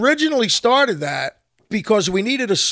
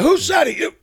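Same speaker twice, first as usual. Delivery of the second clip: playful crowd shout; emcee calling out; 9 semitones higher than usual. Retold or imitated. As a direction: call the guesser up on stage.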